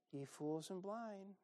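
slow, soft and timid; meek, timid reading. Shown as a demonstration. Preacher mocking a timid Jesus, meek and soft. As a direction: say it like you don't mean it. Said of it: "I'm pretty sure he didn't say".

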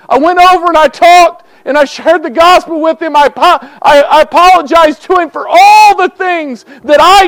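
high, loud revival preaching; preaching at full force. Acted. Preacher confessing his hard heart to the church, preaching loud and high. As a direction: testify to the congregation with rising fire.

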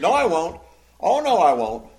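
loud, insistent contradiction; arguing back. Acted. Peter contradicting Jesus' prediction of denial, arguing back. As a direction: flatly refuse to accept it, loudly. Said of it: "he's, he's arguing".

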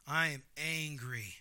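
slow, low, understated anger; quietly seething, measured and slow; displeased Jonah. Acted. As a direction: state your displeasure word by word, contained. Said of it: "So Jonah's like".

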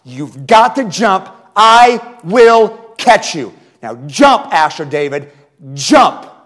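loud, slow, insistent plea; slow earnest pleading. Retold or imitated. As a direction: lean on every word to win his trust. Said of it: "I am pleading with him".

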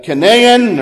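Canaan said as kuh-NAY-un; alternate pronunciation. Shown as a demonstration. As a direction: stress the drawn-out middle syllable of Canaan, kuh-NAY-un. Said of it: "as a lot of Americans say".

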